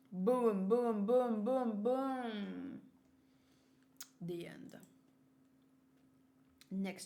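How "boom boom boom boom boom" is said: punchy spoken boom sound effects; mock explosion booms. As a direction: thump out each boom like an explosion. Sound effects while reading manga.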